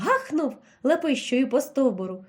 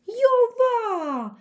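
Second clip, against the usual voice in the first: much higher, drawn-out yelp of sudden pain; wild yelp; 11 semitones higher, 3 dB louder, much slower than usual. A narrator's reading. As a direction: howl it out in a voice not your own. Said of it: "не своїм голосом заволав". (несамовитий крик від болю, дуже високо й протяжно)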